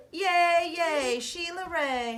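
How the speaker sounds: high, loud cheer; cheering yell. Acted. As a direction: cheer her on like a crowd. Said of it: "her friends yelled".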